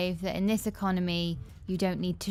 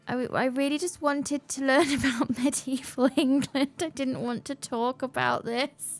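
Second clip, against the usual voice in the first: higher, baffled protest with a laugh; confused; 5 semitones higher than usual. Retold or imitated. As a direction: play the baffled student. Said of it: "he sort of looked at me confused".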